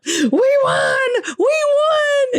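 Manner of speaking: high, loud victory squeal; jubilant cheer. Retold or imitated. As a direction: shriek the good news down the phone. Retold phone call to Dad celebrating.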